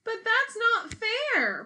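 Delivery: high, loud, slow protest; upset cry. Acted. Paulina protesting to the queen, high loud cry. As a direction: wail it like it's unfair. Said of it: "Paulina cried".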